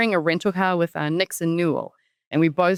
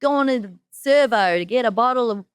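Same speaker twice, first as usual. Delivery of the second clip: a hint of a mock Australian accent; 4 semitones higher than usual. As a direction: tease the Aussie twang lightly. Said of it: "the Australian accent".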